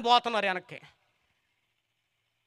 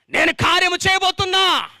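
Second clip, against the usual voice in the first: higher, louder cry of నేను కార్యము చేయబోతున్నా; shouted proclamation; 11 semitones higher, 8 dB louder than usual. Shown as a demonstration. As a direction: belt చేయబోతున్నా out to the crowd. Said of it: "repeated louder". (బిగ్గరగా అరిచి ప్రకటించడం)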